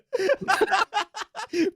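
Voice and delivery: high cackling laugh; helpless laugh; burst of laughter. Real, not acted. Laughing at own stream irony.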